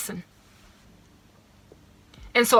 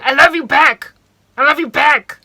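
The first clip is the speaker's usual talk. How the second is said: put-on much louder and higher, playful boyfriend voice; imitating boyfriend; 5 semitones higher, 12 dB louder than usual. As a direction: mimic how he sang it back to her. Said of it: "He never used to say".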